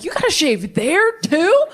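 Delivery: high, slow, incredulous question; a touch of horrified surprise; baffled. Retold or imitated. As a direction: ask it like it's news to you. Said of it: "I'm just like".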